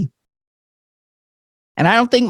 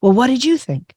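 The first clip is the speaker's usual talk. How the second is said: serious mom; 8 semitones higher than usual. Retold or imitated. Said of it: "she gets all serious and she leans in".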